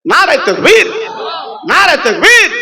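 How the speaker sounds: high, swooping call to the crowd; rallying slogan call. Real, not acted. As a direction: lead the takbir, pitch leaping up. Preacher calls the congregation's slogan. (উঁচু গলায় স্লোগানের ডাক)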